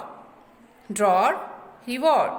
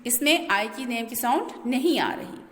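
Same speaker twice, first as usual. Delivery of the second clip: usual speaking voice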